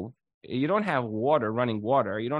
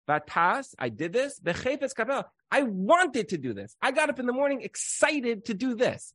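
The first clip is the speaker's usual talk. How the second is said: glad wife; 9 semitones higher than usual. Acted. Woman voicing her glad attitude.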